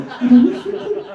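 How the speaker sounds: very high, silly put-on voice; mock self-imitation. Comic mocking his lost momentum on stage, high-pitched dither. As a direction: squeak it, mocking your own dithering. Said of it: "just going".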